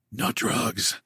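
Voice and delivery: faint, hushed protest; soft drowsy murmur; whispered. A narrator's reading. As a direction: barely voice it, weak and drowsy. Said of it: "Max whispered".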